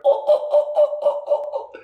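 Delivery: very high squawking oh oh oh, a startled bird; high cackling call. Retold or imitated. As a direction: squawk it out like a pheasant taking off. Pheasant bursting out at him.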